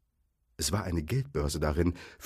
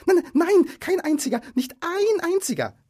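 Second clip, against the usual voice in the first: very high, loud, panicky backtracking; Bilbo's frantic denial; 8 dB louder than usual. A narrator's reading. Hobbit hurriedly protecting his friends, frantic denial. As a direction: take it back in a panic. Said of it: "sagte er sofort hinterher".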